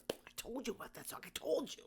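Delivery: hushed aside. Real, not acted. Podcaster to listeners about a favorite song, hushed aside. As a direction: drop to a near whisper.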